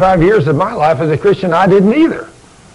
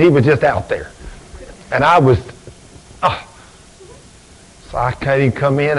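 ordinary talk

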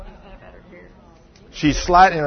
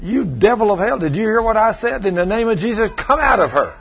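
high-pitched, forceful demon-casting order; retold bold rebuke to a devil; bold command; 6 semitones higher than usual. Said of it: "And I got bold".

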